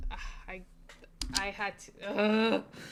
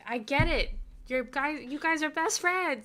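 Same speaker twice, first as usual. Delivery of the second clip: exasperated; 6 semitones higher, 10 dB louder than usual. Real, not acted. Venting annoyance at the book.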